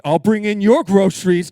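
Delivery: hammed-up suggestive guy; playful mock flirt; flirty husband.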